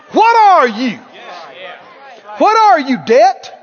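paw like a war horse and shout it; sassy shouted taunt. Acted. Preacher shouting down the devil for the crowd, defiant. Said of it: "flare your nostril a little bit".

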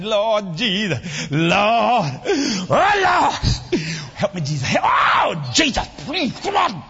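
loud, crying plea to Lord; wailing prayer. Retold or imitated. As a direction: beg loudly, voice breaking. Mock desperate prayer.

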